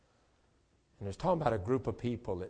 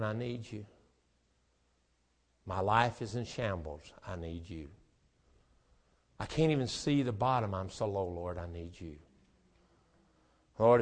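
pleading prayer. Acted. Voices a desperate prayer.

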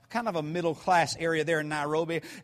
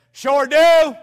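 a firm, loud "Sure do"; loud answer; 8 semitones higher, 14 dB louder than usual. Retold or imitated. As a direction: boom it out to sound bold. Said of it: "I like talking loud".

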